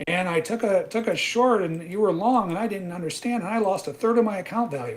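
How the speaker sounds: high, loud, whining retelling; whiny complaining trader. Retold or imitated. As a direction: mimic the confused trader's complaint.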